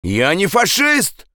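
high, stretched-out shout of denial. Acted. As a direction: protest in a slow, high shout. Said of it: "крикнул Андрес". (возмущённый крик)

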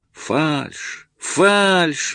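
high, building protest; exclaiming. A narrator's reading. Иванчук protests the singing, covering his ears, exclaiming. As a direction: cry out as if it hurts your ears. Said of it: "воскликнул, затыкая уши".

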